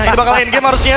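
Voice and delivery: excited. Real, not acted. Caster calls game end.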